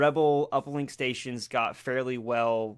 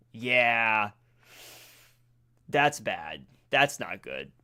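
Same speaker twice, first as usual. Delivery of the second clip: normal talking voice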